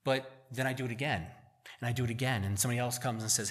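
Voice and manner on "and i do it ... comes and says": ordinary delivery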